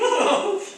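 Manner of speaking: high ticklish giggle; squealing laugh. Acted. Dragon squealing with laughter under tickling. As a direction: laugh helplessly while tickled.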